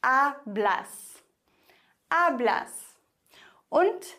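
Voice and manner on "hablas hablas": Hablas with weight on the A, blas falling; stress on first syllable. Shown as a demonstration. Teacher has learners repeat, stressing A in Hablas. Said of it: "die vorletzte Silbe betont".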